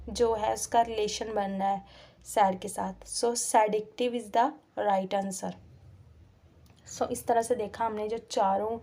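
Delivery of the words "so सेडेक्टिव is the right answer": slow answer statement. Shown as a demonstration. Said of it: "teacher stresses answer".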